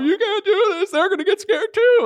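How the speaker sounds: squeaky high, hammed-up pleading; mock near-tears panic. Flight attendant begging a crying colleague to stop, mock near-tears panic. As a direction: imitate a panicky voice fighting back tears. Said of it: "try not to cry himself".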